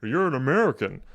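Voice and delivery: lightly imitated American accent; quoting the villain's put-on American accent; Heisenberg. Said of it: "because he's like".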